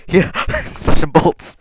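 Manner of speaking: laughing and slow, giggling through the words; real giggle on a misread line; laughing at own slip. Real, not acted. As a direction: crack up while repeating the mistake.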